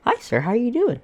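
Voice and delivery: high, bright service-desk greeting; customer service voice. Retold or imitated. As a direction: talk to him like a baby. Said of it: "I love using the customer service voice".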